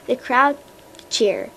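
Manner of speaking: plain reading voice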